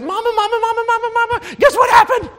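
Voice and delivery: put-on high, loud breathless child calling mama; girl running home, excitedly calling mama. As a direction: burst in shouting for mom. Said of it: "she ran and she said".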